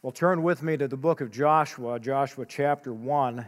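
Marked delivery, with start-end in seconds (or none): none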